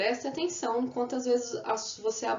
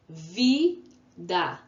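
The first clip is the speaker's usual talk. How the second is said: Vida split into Vi, then da; syllable by syllable; 4 dB louder than usual. Shown as a demonstration. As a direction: hold Vi, pause before da. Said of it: "Também tenho duas sílabas".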